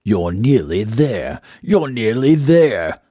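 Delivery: slow, eerie coaxing; voiced, haunting stage whisper; whispery mysterious voice. A narrator's reading. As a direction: draw out the words to lure them closer. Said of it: "the voice whispered".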